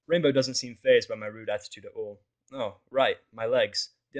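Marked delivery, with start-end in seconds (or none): none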